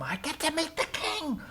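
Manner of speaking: hushed, high mock excitement; excited pleading. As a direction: squeal it quietly, acting out giddy hope. Balaam begs God to go.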